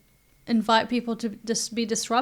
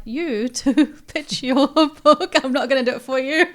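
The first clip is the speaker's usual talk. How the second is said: slow laughing invitation, louder; 3 semitones higher, 7 dB louder than usual. Real, not acted. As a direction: chuckle through the invitation. Host laughs while inviting pitch.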